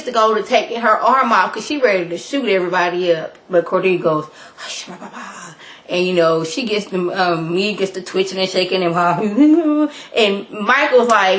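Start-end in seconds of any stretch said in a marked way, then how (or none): 4.6-5.5 breathy whispered hush. Retold or imitated. Cordelia silencing the room, a whispered hush. 9.0-9.9 quick real laugh; short laugh. Real, not acted. Laughing at Miss Mead twitching, a short laugh.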